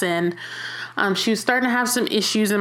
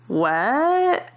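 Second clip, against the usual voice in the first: dumbfounded what; 4 semitones higher than usual. Retold or imitated. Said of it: "so dumbfounded".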